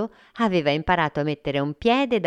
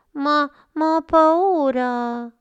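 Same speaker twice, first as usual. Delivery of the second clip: scared little monster; 12 semitones higher, 4 dB louder, slower than usual. Acted. Frullina scared before jumping.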